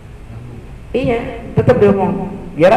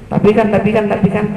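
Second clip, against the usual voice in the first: whining wife; 6 semitones higher than usual. Retold or imitated. Wife keeps protesting.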